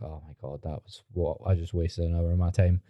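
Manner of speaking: retold quiet dismay, pitch dropped; deflated inner thought. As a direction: mumble it to yourself, let down. Retold deflated thought after webinar.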